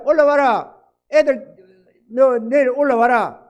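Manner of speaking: loud, firm command to come up; forceful order. Retold or imitated. Uncle on the phone ordering his nephew home, loud and firm. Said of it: "워낙 강하게 뭐라 푸니까".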